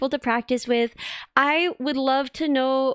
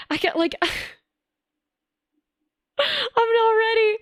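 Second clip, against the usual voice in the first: mock panic; 11 semitones higher than usual. Mock panic of French boy.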